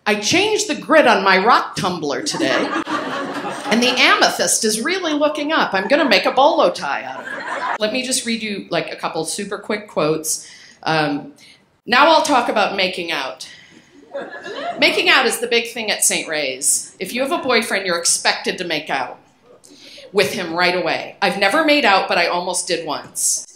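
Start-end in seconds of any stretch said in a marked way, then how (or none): 0.1-7.8 put-on reading teen journal. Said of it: "And then mine was". 11.8-23.4 put-on higher, louder, half-laughing read of a teen diary; loud, giggly diary reading that sends up her younger self; reading old journal aloud. Reads her teenage journal aloud.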